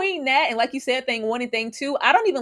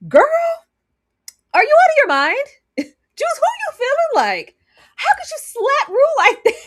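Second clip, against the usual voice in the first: incredulous scolding; 6 dB louder than usual. Real, not acted. Scolding character Jules, laughing.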